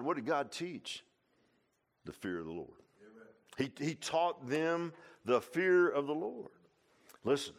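usual speaking voice

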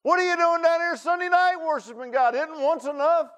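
high, loud scoffing voice of the crowd; mocking townsfolk. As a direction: sneer at the fanatics from the road. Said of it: "He's like".

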